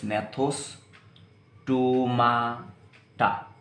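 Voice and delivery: नेथोस्टोमाटा drawn out slowly with a silent G; careful pronunciation. Shown as a demonstration. Teacher modelling नेथोस्टोमाटा for students, slowly. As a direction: pronounce नेथोस्टोमाटा slowly, dropping the G. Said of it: "यहाँ से मैंने pronounce करा नेथोस्टोमाटा".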